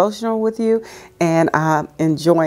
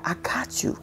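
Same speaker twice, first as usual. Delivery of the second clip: soft reassuring whisper; 6 semitones higher, 12 dB quieter than usual. Acted. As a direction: whisper it close and kind.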